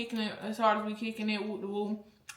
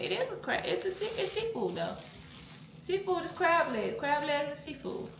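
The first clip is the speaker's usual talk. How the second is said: high insistent voice; 6 semitones higher, 6 dB quieter than usual. Real, not acted. Arguing with kid about seafood.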